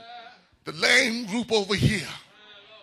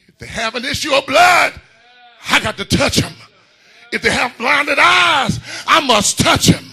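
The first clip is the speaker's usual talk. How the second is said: rising, shouted sermon cadence with sung tones; preaching at full force; 4 semitones higher, 4 dB louder than usual. Acted. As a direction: whoop it up and carry the congregation.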